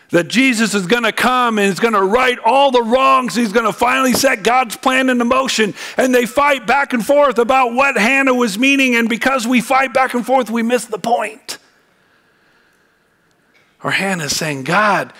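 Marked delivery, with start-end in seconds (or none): 0.1-11.6 high, relentless pulpit run; impassioned preaching. Acted. Preacher warning that arguing misses the point, impassioned. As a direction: push on insistently in a raised preaching voice.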